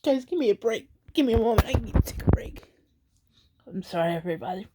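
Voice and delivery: choked up, voice dropping away; real crying. Real, not acted. Asking viewers for a break, crying. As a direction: softly apologize while fighting back tears. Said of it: "I'm crying again".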